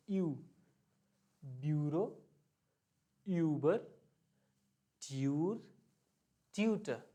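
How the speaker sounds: quick ee-oo glide in ब्यूरो, यूबर, ट्यूर, ट्यूटर; one word at a time, rounded यू in each; U umlaut sound. Shown as a demonstration. Teacher drilling U umlaut examples, deliberate word list. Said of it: "फिर है हमारे पास".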